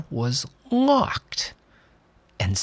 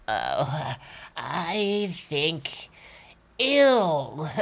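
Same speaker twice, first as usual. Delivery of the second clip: put-on weak old woman voice; 5 semitones higher, 3 dB quieter, much slower than usual. Sick Granny answers.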